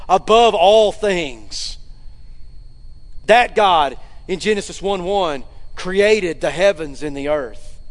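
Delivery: ordinary delivery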